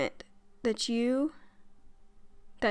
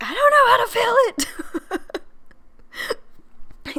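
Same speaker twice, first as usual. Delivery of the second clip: exasperated cry; 13 semitones higher, 6 dB louder than usual. Retold or imitated. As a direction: wail it out in helpless frustration. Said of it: "and I'm like".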